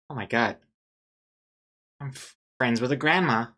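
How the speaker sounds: ordinary talk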